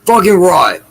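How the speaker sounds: loud mock Australian exclamation; Australian accent. Cheering a cohost's remark in a loud mock Australian accent. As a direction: belt it out in a hammed-up Australian accent. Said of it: "was that an Australian attempt".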